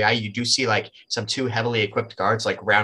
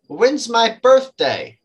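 high, loud, drawn-out question; spring the test question slowly; challenging test; 11 semitones higher, 5 dB louder, much slower than usual. Acted. Short guy tests which one is real, challenging.

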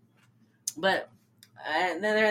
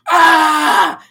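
loud, higher hammed-up scream; mock scream; 8 semitones higher, 14 dB louder than usual. Retelling Tommy's meltdown with a mock scream.

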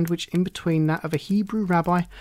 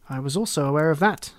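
usual speaking voice

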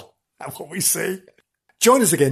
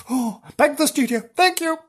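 normal talking voice

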